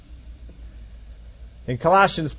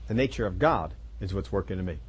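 softer, low repeat of the line; soft echo; 8 semitones lower, 7 dB quieter than usual. Real, not acted. Preacher echoing his point back to the congregation, softer. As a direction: let the repeat sink in, quieter. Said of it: "repeated for emphasis".

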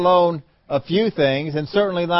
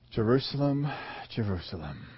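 much softer, lower sorrowful call; lament; 4 semitones lower, 9 dB quieter than usual. Acted. Jesus grieving over Jerusalem, soft and low. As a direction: mourn over the city, fading.

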